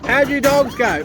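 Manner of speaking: high, slow call across the paddock; calling the dogs. Real, not acted. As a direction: sing it out to the dogs.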